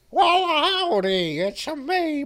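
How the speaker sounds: high, slow cartoon howdy; Paul Giamatti. Actor introducing himself to listeners, mock cartoon holler. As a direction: stretch it out in a hammed-up cartoon voice. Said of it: "I'm now doing the Looney Tunes".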